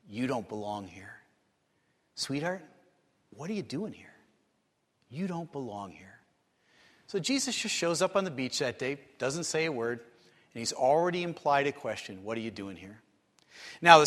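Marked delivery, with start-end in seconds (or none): 0.1-6.2 hushed, tender voice of a father gently scolding; soft fatherly rebuke. Retold or imitated. Father hushed to his little girl at the movies, gently disappointed. 12.2-13.0 gentle question. Retold or imitated. Jesus' implied question, voiced softly.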